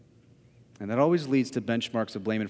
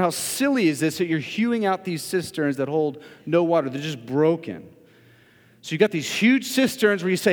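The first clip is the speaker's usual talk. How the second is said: louder, driving sermon voice, slowing on the big lines; full-force preaching; 8 semitones higher, 8 dB louder than usual. Acted. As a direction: preach it out with building fervour.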